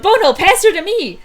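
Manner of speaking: high, loud battle shout; urgent call. Acted. Calling to Bono to hand over Thunder, urgent high shout. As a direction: yell it across to a teammate. Calls to partner mid-battle.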